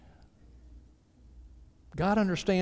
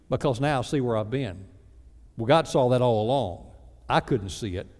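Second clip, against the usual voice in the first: ordinary talk, nothing marked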